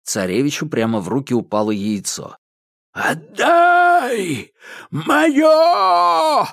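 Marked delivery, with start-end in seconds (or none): 2.9-6.4 high, loud wailing howl; вой Кощея. A narrator's reading. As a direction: howl it out like a dying sorcerer. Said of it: "взвыл Кощей". (взвыл Кощей)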